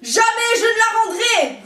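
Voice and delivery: loud, high furious refusal; roaring. A narrator's reading. Cactus refusing the rooster, loud roar. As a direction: roar it, won't give in. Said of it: "rugit le cactus".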